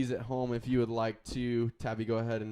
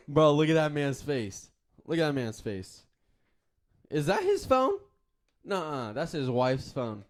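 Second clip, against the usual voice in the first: excited; 5 semitones higher, 7 dB louder than usual. Acted. Joking about man in photo.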